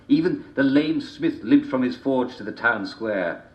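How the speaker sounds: ordinary delivery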